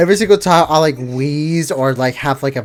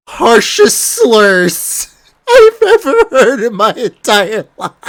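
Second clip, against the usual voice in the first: laughing; 7 semitones higher, 4 dB louder than usual. Real, not acted. Laughing while recounting.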